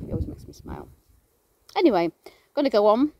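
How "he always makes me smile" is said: soft low whisper, trailing off. Real, not acted. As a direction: breathe it fondly, almost whispered. Fond remark, hushed.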